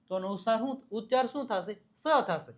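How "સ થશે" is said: normal talking voice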